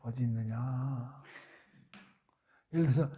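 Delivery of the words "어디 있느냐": slow, soft gentle call. Acted. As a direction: call him gently, no scolding. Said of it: "이렇게 부를 때". (천천히 부드럽게 부르는 하나님)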